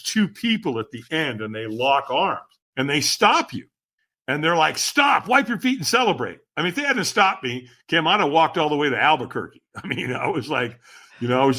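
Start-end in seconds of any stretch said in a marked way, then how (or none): 4.8-6.4 higher-pitched call to halt someone; urgent instruction. Retold or imitated. Helpers stopping a dazed fire walker, urgent retold instruction. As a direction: imitate a helper calling out, pitched up.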